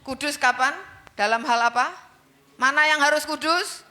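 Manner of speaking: high, loud sermon, each word driven hard; fiery preaching. Acted. Preacher urging the church to be holy, loud fiery sermon. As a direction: preach it to the congregation with fire.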